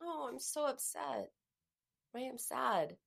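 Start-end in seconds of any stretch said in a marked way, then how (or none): 0.0-1.3 soft, moaning lament; softer groaning take of the same words; feeling it quietly. Shown as a demonstration. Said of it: "Feeling it is".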